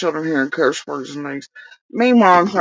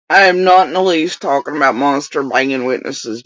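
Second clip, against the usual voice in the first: ordinary delivery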